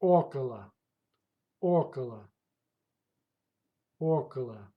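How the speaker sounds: "Около" with the first o stressed and the rest falling away; stress on first vowel. Shown as a demonstration. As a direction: hit the opening O of "Около". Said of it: "The stress goes to first vowel".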